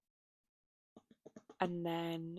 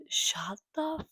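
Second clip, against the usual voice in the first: hushed cut-off curse; whispered; 10 semitones higher, 6 dB louder, slower than usual. Real, not acted. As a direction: whisper the swear under breath.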